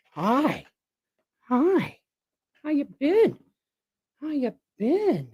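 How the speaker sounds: high-pitched pet greeting. Real, not acted. Owner asking Shopcat how he's been, high cooing. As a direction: coo it high to a cat. Baby talk to the cat.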